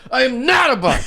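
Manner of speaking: put-on high, loud indignant child voice; upset little kid. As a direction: protest like an angry toddler. Dad voices upset son.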